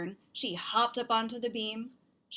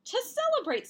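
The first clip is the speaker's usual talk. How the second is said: much higher, a bit louder, delighted; mom's happy voice; 4 dB louder than usual. Acted. Mom tells family why, much higher and brighter. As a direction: sing it out high like good news. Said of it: "said her mom".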